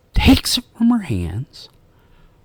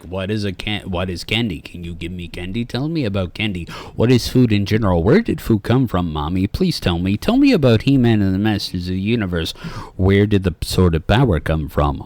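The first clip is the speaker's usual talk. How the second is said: lower, quieter kid badgering with questions; mock a pestering little kid, no breaths; lightly voiced nagging child, questions tumbling out; five-year-old asking; 6 semitones lower, 3 dB quieter, faster than usual. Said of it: "like, a five-year-old walk up".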